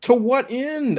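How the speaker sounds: exasperated question shooting up high; high-pitched exasperation. Real, not acted. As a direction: pitch TO WHAT END way up in disbelief. Repeats the question, more exasperated.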